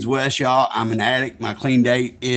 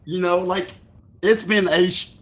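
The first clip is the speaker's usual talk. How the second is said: ordinary delivery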